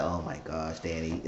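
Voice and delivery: retold reaction under the voice; hushed dismay. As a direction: drop right down as if aghast.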